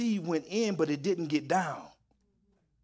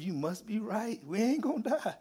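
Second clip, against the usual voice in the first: high, softer quote breaking into laughter; amused retold realisation; 5 semitones higher, 4 dB quieter than usual. Troop to sergeant after surviving mortar, laughingly quoted. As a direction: imitate the troop, then crack up.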